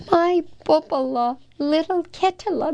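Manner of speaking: high, joyful exclamation; exclaimed. Acted. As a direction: cry out with relief at the found cat. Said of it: "Missus Katz exclaimed".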